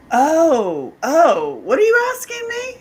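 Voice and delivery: loud ohs climbing into high question; startled realization. Retold or imitated. Woman realizing what he really meant, high and startled. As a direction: act the penny dropping, taken aback. Acting out her sudden realization.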